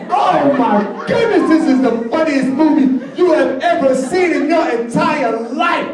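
high, giggly amused exclaiming; laughing hypnotist describing a funny film; laughing along. Acted. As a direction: sell how hilarious the movie is. Acting out a hilarious movie.

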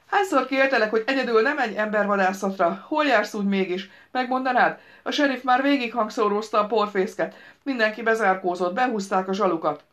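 scolding woman on phone. Acted. The daughter scolds her father over the phone, high and loud. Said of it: "folyondásszerű női hang".

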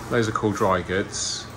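usual speaking voice

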